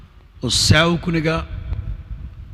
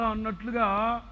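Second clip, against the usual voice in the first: ordinary talk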